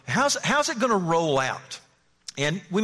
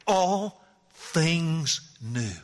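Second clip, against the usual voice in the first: very slow savoring; much slower than usual. Acted. Preacher savoring God's promise, very slowly. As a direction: stretch all things new way out.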